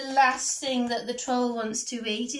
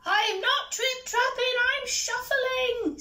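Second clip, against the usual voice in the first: high, squeaky little troll voice; very small voice; 14 semitones higher, 3 dB louder than usual. A narrator's reading. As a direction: protest in a tiny high-pitched voice. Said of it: "in a very small voice".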